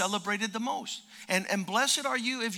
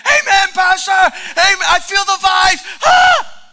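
very high, loud hammed-up amens; mock church shouting; 11 semitones higher, 15 dB louder than usual. Preacher mocking churchgoers answering him, loud hammed-up amens. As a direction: ham up a fired-up churchgoer. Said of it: "people were like".

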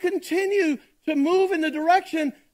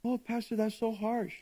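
much softer, lower, imitating a hurt listener; a hint of a wounded complainer; mock whine; 4 semitones lower, 11 dB quieter than usual. Listener complaining to the pastor, soft mock whine. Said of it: "imitating a complaining listener".

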